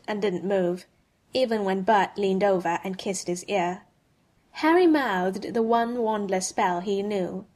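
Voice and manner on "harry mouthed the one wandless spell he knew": ordinary delivery, nothing marked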